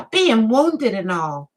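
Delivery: a hint of a 1930s film kid; Little Rascals voice. Shown as a demonstration. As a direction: lightly mimic an old TV kid. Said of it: "I felt like I was on a Little Rascal".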